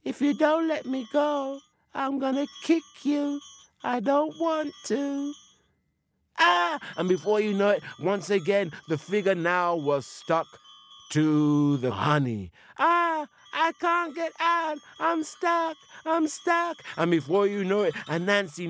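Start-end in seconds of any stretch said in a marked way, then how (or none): 0.0-5.3 high spirit voice, complaining and threatening; a high little spirit with one leg left; pleading forest spirit. Acted. Spirit stuck, threatens again. 6.4-6.8 loud high-pitched ah; high spirit yelp. Acted. Spirit kicks and sticks. 12.8-16.8 high spirit voice, stuck and fretting; a high little spirit that can't get out; helpless stuck spirit. Acted.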